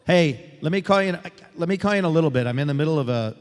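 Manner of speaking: loud phone call over a crowd; projecting to a listener on the line. Real, not acted. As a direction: speak up firmly into the phone. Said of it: "so she can hear me".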